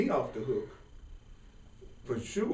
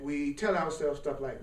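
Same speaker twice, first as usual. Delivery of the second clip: ordinary talk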